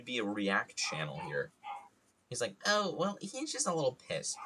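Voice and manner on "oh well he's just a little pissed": high-pitched imitation of a nosy aunt; put-on, much higher gossip voice; mock commentary. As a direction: mimic a busybody remarking aloud. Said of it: "obnoxiously loud about his actions".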